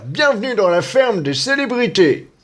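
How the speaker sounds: announcer-style welcome; game show host. Pretend. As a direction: introduce it like a TV host. Mock TV host intro.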